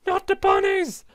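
high, loud wail of horror; dramatic dismay. Acted. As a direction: cry out in over-the-top panic, pitch soaring. Distressed outcry over the bunnies.

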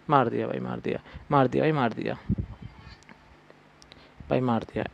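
low, soft repeated lament; deflated resignation. Real, not acted. Player just killed in the game, low deflated voice. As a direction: let the voice sink in defeat.

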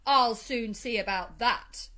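slow, loud, determined threat; emphatic, indignant resolve; defiant. A narrator's reading. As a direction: vow to sort them out. Toad seizes stick, defiant.

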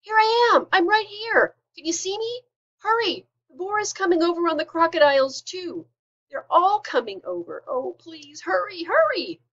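high, loud, desperate calls for help; baby dragon yelling in excited panic; frantic yelling. A narrator's reading. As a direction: jump up and down and yell for rescue.

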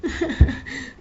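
a short high laugh, mostly breath; high breathy laugh. Acted. Laughs after the apology.

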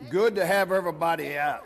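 usual speaking voice

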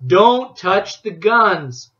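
slow, loud furious yell; explosive rage. A narrator's reading. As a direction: bark it like an enraged businessman. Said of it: "fair impression of an enraged businessman".

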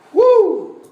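sudden high whoop of discovery; excited whoop. Acted. Woman glimpsing her lost coin, high excited whoop. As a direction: let out a surprised yelp.

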